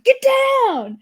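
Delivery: imitating an anime character's yell, drawn out; mock scream. Fan recalling One Piece subtitles, mock screaming. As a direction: yell it like a hammed-up dub line. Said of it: "they were, like, screaming".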